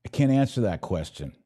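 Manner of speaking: usual speaking voice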